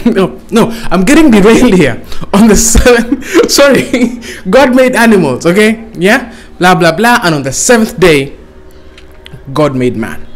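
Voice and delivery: normal talking voice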